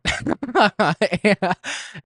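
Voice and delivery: loud, high burst of laughter; real laughing fit. Real, not acted. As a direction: crack up at your own answer. Cracks up after naming In-N-Out.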